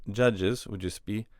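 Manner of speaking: normal talking voice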